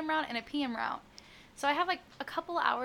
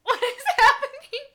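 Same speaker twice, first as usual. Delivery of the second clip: laughing; 11 semitones higher, 8 dB louder than usual. Real, not acted. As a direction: laugh through it, baffled. Pipes making noise, laughing.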